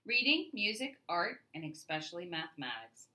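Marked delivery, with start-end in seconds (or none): none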